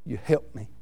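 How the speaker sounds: quiet father. Retold or imitated. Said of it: "he said".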